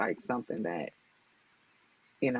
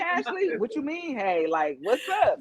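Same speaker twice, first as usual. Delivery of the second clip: laughing mock greeting; 12 semitones higher than usual. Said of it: "I hit 'em with a".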